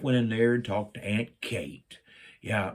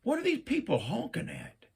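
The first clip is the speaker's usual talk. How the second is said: puzzled; 12 semitones higher, 5 dB quieter than usual. Retold or imitated. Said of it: "I thought".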